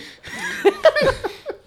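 high-pitched burst of laughter. Real, not acted. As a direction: squeal with laughter. Big laugh at pun.

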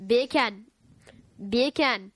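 "beer can" repeated to sound like Jamaican "bacon"; British "beer can" as Jamaican. Shown as a demonstration. Working into a Jamaican cow voice, accent warm-up. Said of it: "I say beer can with a British accent".

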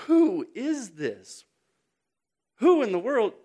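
loud, high, drawn-out question; astonished. Acted. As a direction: preach it with mounting amazement. Preacher acts out disciples' astonished question.

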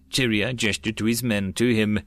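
ordinary delivery

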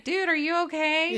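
high, loud imitation of a worried date; alarmed concern. Acting out John following her from the club, alarmed concern.

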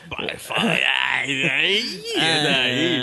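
mock whiny, crying voice, stretched slow; imitating Caio. Host teasing co-host Caio by copying his voice, whiny mock cry. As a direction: whimper the words out like a teasing caricature. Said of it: "Fala igual esse".

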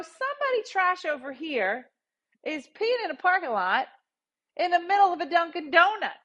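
high-pitched indignant tirade; mouthing off. Retold or imitated. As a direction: tell the whole lot off, scandalized. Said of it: "So I start mouthing off".

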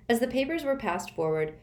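usual speaking voice

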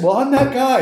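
high, loud, gleeful reveal; proud reveal. Retold or imitated. Telling bikers he knocked out teeth, high and loud. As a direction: punch it out like a cocky confession.

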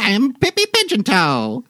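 high, loud squeaky gnome introduction; new gnome voice. Acted. Game master tries another voice for Pippi, high and loud. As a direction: try a fresh silly gnome voice. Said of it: "Is that better or worse?".

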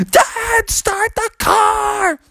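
very high, drawn-out shriek; panicked shout. Acted. Froggy begs dad to flee, panicked shout. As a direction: scream it in acted panic. Froggy screams as bear nears.

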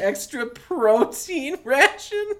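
helpless, high laughing repeat; incredulous, laughing and drawn out; cracking up. Real, not acted. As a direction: stretch the words out through laughter. Cracking up at the co-host's joke.

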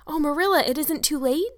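put-on high, loud, breathless girl; excited. A narrator's reading. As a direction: burst out with sudden hope. Anne flies up like a rocket.

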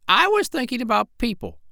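put-on very high old parrot voice; the parrot musing to the doctor, very high voice; parrot Polynesia. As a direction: play the parrot way up in the head. Parrot character in story.

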